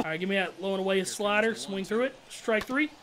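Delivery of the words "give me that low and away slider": usual speaking voice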